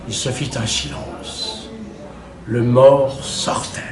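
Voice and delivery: very soft, low narration; hushed solemnity. A narrator's reading. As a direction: drop the voice low for a sudden silence. Silence as the dead man leaves. (tout bas, grave et solennel)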